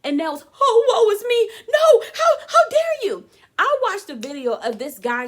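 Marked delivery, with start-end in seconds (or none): 0.6-3.3 high, loud, hammed-up indignant wail, laughing; mock outraged whine. Mocking defensive women, loud high wail. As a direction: play the offended drama queen.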